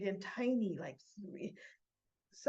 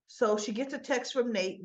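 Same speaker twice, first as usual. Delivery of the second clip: plain reading voice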